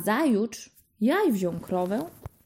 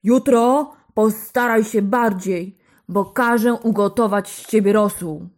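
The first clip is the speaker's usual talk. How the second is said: furious roar; 8 dB louder than usual. A narrator's reading. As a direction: roar it like an angry ogre. Enraged giant threatens the hen.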